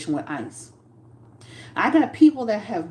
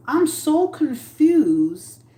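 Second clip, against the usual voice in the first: very slow, higher, louder; mock-baffled, stretched and high; exaggerated confusion; 7 semitones higher, 4 dB louder, much slower than usual. Real, not acted. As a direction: drag it out in disbelief. Mocking a [name] quote.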